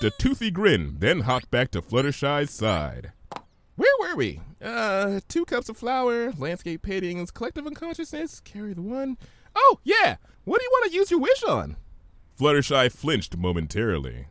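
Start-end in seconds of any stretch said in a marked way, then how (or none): 3.8-11.8 very high, rapid, scatterbrained chatter; bubbly high pony voice. Acted. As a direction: bounce through it like an excitable pony. Narrator voices bubbly Pinkie.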